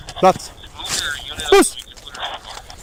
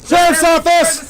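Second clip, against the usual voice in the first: shouted announcement; 12 semitones higher, slower than usual. Real, not acted. Announcing before entering room.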